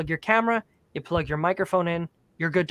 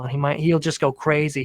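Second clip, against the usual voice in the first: ordinary talk, nothing marked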